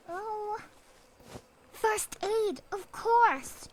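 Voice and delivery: grab the item with delight; excited find. Real, not acted. Gamer finding a first aid kit, high and excited.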